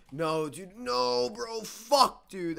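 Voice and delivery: high, loud cry of frustration; anguished outburst. Real, not acted. Gamer who just wasted an item, anguished outburst. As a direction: burst out, rising to a yelled curse.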